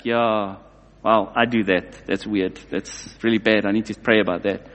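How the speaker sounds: sheepish realization. Acted. Congregation member recognizing their own sin, low and sheepish. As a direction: mumble it as if caught out.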